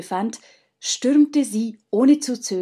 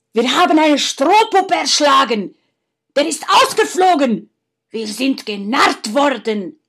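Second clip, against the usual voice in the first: loud, much higher, disbelieving shout; stunned outcry; 5 semitones higher, 8 dB louder than usual. Acted. Faustus finding a straw doll in the bed, stunned shout. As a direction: cry out, unable to believe you were fooled. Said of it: "rief er fassungslos".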